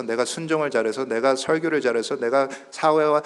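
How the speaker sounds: ordinary delivery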